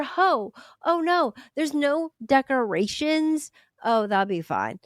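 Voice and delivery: high, sing-song fake dismay, then breezy dismissal; mock concern. Organizer spotting missing decorations, mock-concerned then unbothered. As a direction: play clueless alarm that settles into a shrug. Mocking an organizer shrugging off problems.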